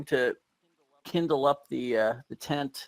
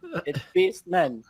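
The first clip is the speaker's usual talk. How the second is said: mock yell; 3 semitones higher than usual.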